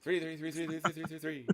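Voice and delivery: high rapid auction chant; auctioneer. Pretend. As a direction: rattle off the bids like an auctioneer. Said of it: "break into an auctioneer's voice".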